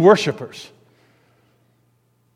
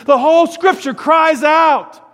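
much higher and louder, slow ringing proclamation; emphatic cry; 11 semitones higher, 6 dB louder, slower than usual. Real, not acted. Preacher crying out to the congregation about the Scriptures, loud and slow. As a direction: cry it out so the back row hears. Climax of sermon, cries out.

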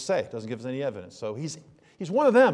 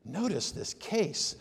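ordinary talk

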